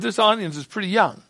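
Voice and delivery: ordinary delivery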